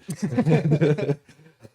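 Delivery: loud bright laugh, falling off; big burst of laughter. Real, not acted. Laughing at own joke.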